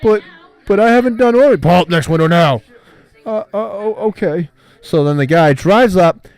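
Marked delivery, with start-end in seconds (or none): 0.0-1.6 much higher, loud, whiny protest; high, indignant customer. Retold or imitated. Customer protests at the drive-thru. 1.6-2.6 loud, curt repeated order; blunt worker repeats the order. Retold or imitated. 3.2-4.5 higher, hesitant giving in; high, meek customer gives in. Retold or imitated.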